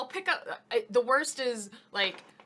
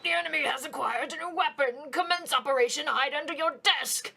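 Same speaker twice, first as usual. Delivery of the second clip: dramatic announcement; 7 semitones higher, 5 dB louder than usual. Acted. Voicing game character line.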